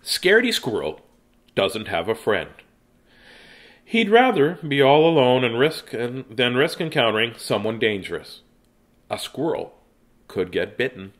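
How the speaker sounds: storytime read-aloud with dramatic pauses; reading to children. Acted. Reads picture book aloud.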